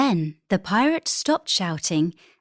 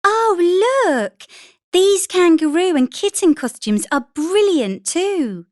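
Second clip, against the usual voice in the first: higher, louder, eager mum; excited mother; 10 semitones higher, 4 dB louder than usual. A narrator's reading. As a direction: enthusiastic mother showing costumes. Mother voiced in story.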